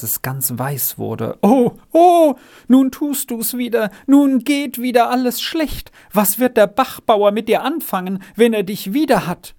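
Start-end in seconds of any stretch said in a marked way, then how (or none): 1.4-9.5 high, loud cry of alarm easing into anxious lament; frightened dismay. A narrator's reading. Margretli learns Renz ran away again, horrified and alarmed. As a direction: wail in alarm at what is coming. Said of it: "Jetzt erschrak das Margretli so".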